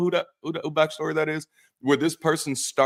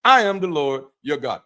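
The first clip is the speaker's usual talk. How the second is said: swaggering God; 3 semitones higher, 4 dB louder than usual. Acted. God signing off to his people, swaggering. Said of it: "It's like, "I'm [name]".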